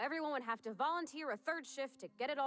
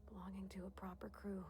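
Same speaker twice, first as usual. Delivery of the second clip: whispered longing; 2 semitones lower, 10 dB quieter than usual. Acted. Dreamy confession.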